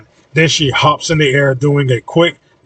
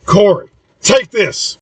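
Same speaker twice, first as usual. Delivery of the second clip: higher-pitched, drawn-out yell; stretched-out call, pitched up; 7 semitones higher, slower than usual. A narrator's reading. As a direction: call it out high as you toss something. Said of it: "and yells".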